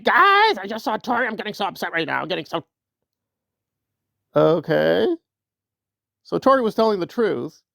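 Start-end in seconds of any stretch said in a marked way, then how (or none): 0.0-2.6 high, over-the-top "Guys" fading into fretting; hammed-up impression of someone getting upset; mock upset Nora. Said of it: "Nora got upset. She was like".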